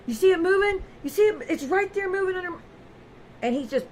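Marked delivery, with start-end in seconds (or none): 0.1-2.5 frantic husband. Retold or imitated. Voicing husband's hallucination.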